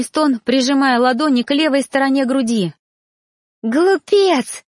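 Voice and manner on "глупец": high, hysterical shriek. A narrator's reading. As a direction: scream the insult. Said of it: "истерила Сария".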